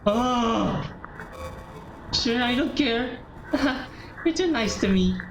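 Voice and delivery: high-pitched lovestruck character voice; infatuated girl, gushing. Acted. Girl begging to keep his poem, gushing. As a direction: coo over him up in a high register.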